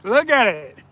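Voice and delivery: thrilled dad cheering; excited cheering. Real, not acted. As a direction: cheer him on as the fish comes in. Cheering son landing fish.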